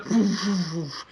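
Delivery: soft puffed whooshing noises; imitated lightning whooshes. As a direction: blow out three soft magic zaps. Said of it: "and he goes".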